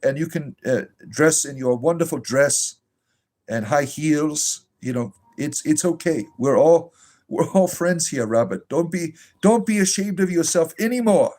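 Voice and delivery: syrupy, sing-song reassurance, hammed-up; mock pastoral comfort. A mock pastor comforting Robert, syrupy and over-sweet. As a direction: lay the fake sympathy on thick like a gushing pastor.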